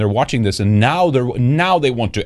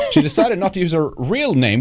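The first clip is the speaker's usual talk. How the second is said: ordinary delivery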